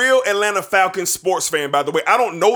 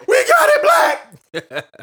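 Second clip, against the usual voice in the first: ecstatic shout; much slower than usual. Retold or imitated. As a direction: scream it like you can't believe it. Celebrating the recovery.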